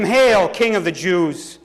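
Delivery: slow, drawn-out mock hail; mocking call. A narrator's reading. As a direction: call out a sham salute. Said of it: "began to call out to him".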